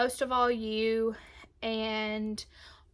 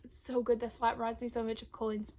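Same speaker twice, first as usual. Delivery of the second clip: usual speaking voice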